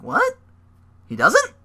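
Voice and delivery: can't believe the booking; shocked fan reaction; surprised. Real, not acted. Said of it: "I'm like".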